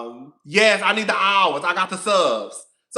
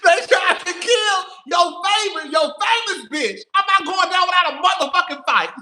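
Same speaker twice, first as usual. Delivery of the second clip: defiant shout; 8 semitones higher than usual. Real, not acted. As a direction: yell it like you're rallying for a fight. Defiant rant about losing her page.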